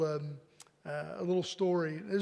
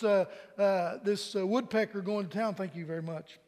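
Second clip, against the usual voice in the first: usual speaking voice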